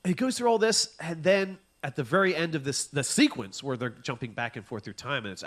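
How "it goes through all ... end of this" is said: usual speaking voice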